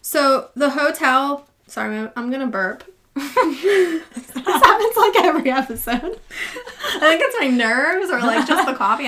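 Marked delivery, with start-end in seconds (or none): 3.2-9.1 laughing. Real, not acted. Admits burping, laughs at herself.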